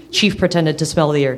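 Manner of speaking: usual speaking voice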